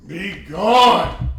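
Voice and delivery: high, full-voiced banishing shout; booming, commanding incantation; yelled spell. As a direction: yell it like casting out a monster. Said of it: "and yell".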